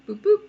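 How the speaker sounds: high, loud playful boops; squeaky drawing sound effect, rising; little boop noises. Real, not acted. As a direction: pop out two bright boops while drawing. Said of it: "You don't have to say boop".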